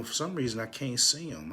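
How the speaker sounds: ordinary delivery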